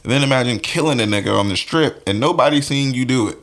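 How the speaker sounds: normal talking voice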